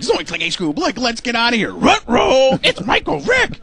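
high, panicky cartoon voice climbing up; Shaggy and Scooby. Comedian mimics Shaggy and Scooby spotting danger, panicky. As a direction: yelp it like a scared cartoon dog. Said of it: "when Shaggy and Scooby see the bad guy".

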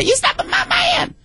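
drawn-out imitation of a Springer guest; mock talk-show guest.

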